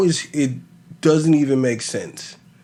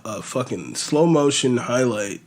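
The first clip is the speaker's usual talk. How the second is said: ordinary talk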